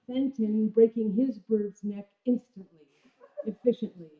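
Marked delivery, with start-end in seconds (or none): none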